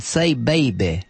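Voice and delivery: slow, drawn-out imitation of a faith healer's cry; a light mock of a preacher's call; Ernest Angley. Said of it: "who is that one that said".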